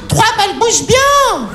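high, slow appraising voice; high mock assistant. Assistant judging his testicles, high and slow. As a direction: drag it out high like an impressed nurse. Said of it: "elle a fait un".

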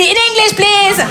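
high-pitched English demand; acted plea. Comedian replaying her retort to a homeless man, high-pitched plea.

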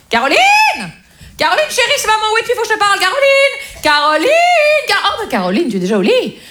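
hammed-up fussy mother yelling through the flat; mother calling out. Mother barging in, calling for her daughter, high and shrill-voiced.

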